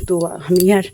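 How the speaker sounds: ordinary talk, nothing marked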